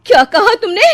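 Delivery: loud, high enraged demand; furious. Acted. Mother-in-law stung by the insult, loud and furious. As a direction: seethe with rage. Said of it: "तिलमिला उठती है".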